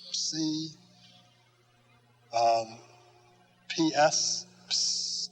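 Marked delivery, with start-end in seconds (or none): 4.7-5.3 "ps" hissed with no voice, like calling a cat; "ps" the way you'd call a cat. Shown as a demonstration. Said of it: "like you're calling the cat".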